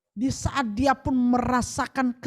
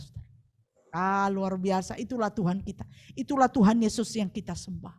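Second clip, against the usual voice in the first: normal talking voice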